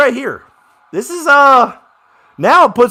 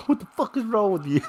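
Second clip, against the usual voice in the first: quick, soft quote broken by laughter; laughing through the quote; 3 semitones higher, 11 dB quieter, faster than usual. Retold or imitated. Fan repeating a coach's outburst to himself, laughing. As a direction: giggle while repeating the coach's line.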